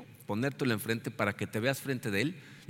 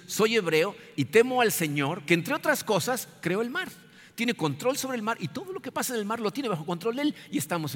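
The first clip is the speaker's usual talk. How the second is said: Jonah paraphrased; 7 semitones higher than usual. Acted. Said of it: "lo que le está diciendo es".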